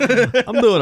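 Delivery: high, slow and giggly; laughing. Real, not acted. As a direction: answer through a laugh. Laughing reply to how are you.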